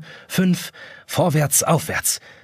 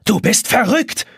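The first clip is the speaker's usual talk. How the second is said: roaring; 7 semitones higher, slower than usual. A narrator's reading. Jonas attacking the watch, a mild roar. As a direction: roar it as you charge. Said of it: "brüllt Jonas".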